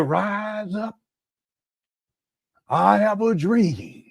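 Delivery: high, stretched-out oratory with long held vowels; Martin Luther King. Pretend. Commentator mocking a rival as a dream-speech orator, slow high oratorical parody. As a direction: parody of a grand civil rights speech, drawn out slowly. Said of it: "Martin Luther King giving a speech".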